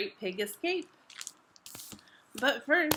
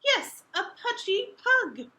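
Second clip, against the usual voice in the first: high teasing voice; teasing Pug; 9 semitones higher, 3 dB louder, slower than usual. Acted. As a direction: poke fun at Pig, pitched up. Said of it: "says Pug".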